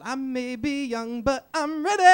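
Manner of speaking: sultry diva voice rising high on ready. Pretend. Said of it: "the worst [name] impression".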